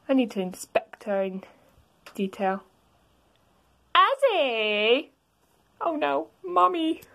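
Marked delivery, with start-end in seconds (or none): 4.0-7.0 higher mom voice calling out; mother calling. Acted. Toriel calling Azzy, higher mom voice. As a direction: call your son like a scolding mom.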